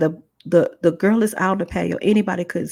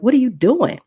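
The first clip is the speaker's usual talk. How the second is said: high, louder demand to know; startled protest; 6 semitones higher, 6 dB louder than usual. Retold or imitated. Woman objects as the baby is put down, startled protest. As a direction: retell an alarmed challenge.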